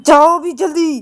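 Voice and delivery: high loud shout to hurry her. Acted. Payal shouts at sleepy Ananya to hurry. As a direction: yell at her to go quickly. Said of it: "चिल्ला क्यों रही हो मेरे ऊपर".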